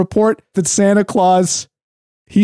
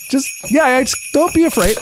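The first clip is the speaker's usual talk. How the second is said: usual speaking voice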